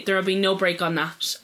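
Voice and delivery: ordinary delivery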